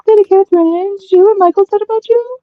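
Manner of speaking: high, loud Aussie caller impression; Australian accent. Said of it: "Did you like my Australian accent".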